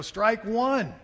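loud, high umpire shout; umpire's call. Retold or imitated. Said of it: "I hear the umpire go".

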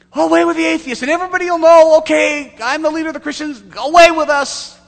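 high, very loud mock proclamation; loud, high, hammed-up shouting; demonstrated public cry. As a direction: call it out to a whole crowd. Demonstrates the cry officials want.

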